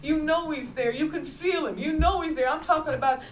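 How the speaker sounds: call-and-response testimony; preaching. Real, not acted. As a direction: lift the congregation with each line. Testifying about God's presence.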